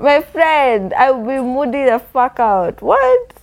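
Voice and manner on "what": high indignant what. Real, not acted. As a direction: fire back in disbelief. Outraged reaction.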